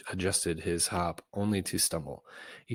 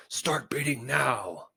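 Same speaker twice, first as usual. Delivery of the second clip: shouted order; 7 semitones higher, slower than usual. Acted. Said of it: "and shouted".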